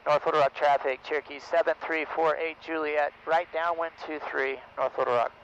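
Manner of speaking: loud, clipped pilot radio call. Real, not acted. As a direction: announce position over the radio.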